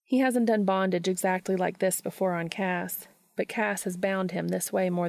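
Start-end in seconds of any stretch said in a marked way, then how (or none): none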